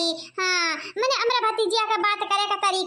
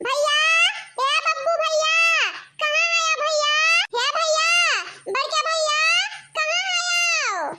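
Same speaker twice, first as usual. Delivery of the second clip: high, drawn-out call for big brother; 6 semitones higher, slower than usual. Acted. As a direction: shout for brother through the house. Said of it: "इतना ना चिल्ला बहिनी". (भैया को ऊँची आवाज़ में पुकारना)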